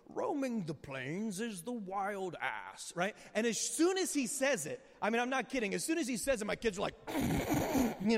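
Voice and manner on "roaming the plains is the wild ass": put-on slow British documentary narrator accent; slow, measured British nature-film narration; David Attenborough British accent. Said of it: "in his great British accent".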